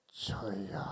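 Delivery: hushed unvoiced call; whisper. Mother peeks into the too-quiet room, whispering. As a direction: breathe the word, afraid of silence.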